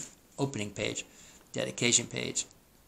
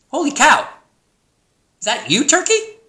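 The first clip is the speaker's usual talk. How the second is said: high, loud pig voice; astonished pig; 11 dB louder than usual. A narrator's reading. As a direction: squeal it like a surprised storybook pig. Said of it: "snorted Pig".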